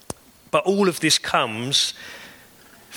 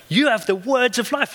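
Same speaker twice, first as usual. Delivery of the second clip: much higher, ringing cry of faith; fervent exclamation; 12 semitones higher than usual. Real, not acted. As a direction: shout it out as the peak. Climax of the sermon.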